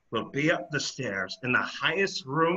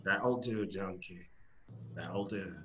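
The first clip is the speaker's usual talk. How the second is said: calm reassurance; 10 semitones lower, 10 dB quieter than usual. Acted. Shrek calmly soothes Donkey.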